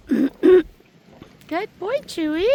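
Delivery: very high praise voice; dog praise. Real, not acted. Dog sitter praising Charcoal, very high.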